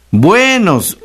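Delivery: Buenos with the E pushed high and held; exaggerated stress on E. Shown as a demonstration. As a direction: overdo the accent on the e of Buenos. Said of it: "con acento en la E". (Buenos, con la e muy acentuada y aguda)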